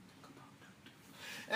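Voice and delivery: slow, hushed whisper. Real, not acted. Host urging viewers to consider their daughters, whispered. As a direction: let it sink in as a drawn-out whisper. Whispered echo of the closing appeal.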